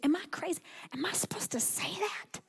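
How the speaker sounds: whispered self-doubt, barely voiced; hushed, worried whisper to yourself; anxious whisper. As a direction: breathe it out as a nervous secret. Anxious inner doubt while praying.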